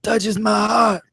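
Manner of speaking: milk the fake sobs; mock crying. Host pretends the praise moves him to tears. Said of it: "I'm kidding".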